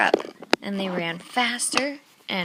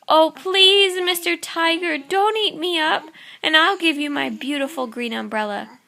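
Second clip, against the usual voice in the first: put-on high, pleading child's voice, louder; pleading little boy; 6 semitones higher, 6 dB louder than usual. Little Babaji begging the tiger, high pleading voice. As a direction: beg the tiger sweetly in a high voice.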